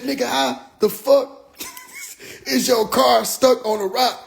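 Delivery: high, slowed-down disbelief; comically exasperated high-pitched questioning; imitated incredulous mother. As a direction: retell her baffled question, high and drawn out. Mother reacts to stuck car.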